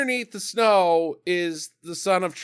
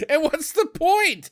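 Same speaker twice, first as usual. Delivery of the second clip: exasperated; 9 semitones higher, slower than usual. Real, not acted. Exasperated at the film's silly plan.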